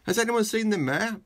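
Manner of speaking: a flustered clerk asking around; town clerk asking. Acted. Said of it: "asked the town clerk".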